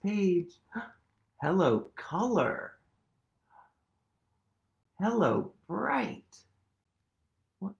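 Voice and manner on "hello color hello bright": high, lively picture-book voice; reading to children. Acted. Teacher reading a picture book to kids, lively. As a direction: read it bright for little ones.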